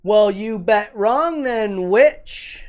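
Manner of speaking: high, loud and slow; mock-snooty put-down; sassy witchy voice. Sassy retort to James.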